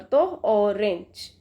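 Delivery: Orange drawn out slowly so the O is heard; slow model word. Shown as a demonstration. Teacher modelling the vowel for students, slow. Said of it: "ओ की sound आ रही है यहाँ पर".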